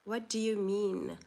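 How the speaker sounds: ordinary delivery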